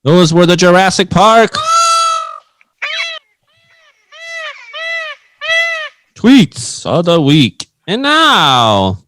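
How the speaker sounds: big, drawn-out announcer build; show announcer. Acted. Announces segment outro and intro.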